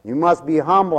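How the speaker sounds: normal talking voice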